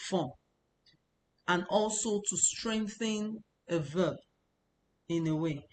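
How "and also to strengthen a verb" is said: usual speaking voice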